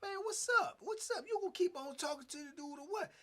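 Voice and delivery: high, sharp challenge in a mock tough voice; confronting challenge. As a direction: call him out, imitating the boss. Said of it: "Man, Spoon say".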